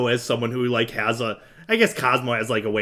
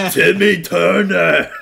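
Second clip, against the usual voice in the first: drawn-out cartoon impression, louder; Timmy Turner; 4 semitones higher, 6 dB louder, much slower than usual. Friend reluctantly trying his impression, loud drawn-out cartoon voice. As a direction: stretch out a loud, high cartoon voice. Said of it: "do my, uh, impression".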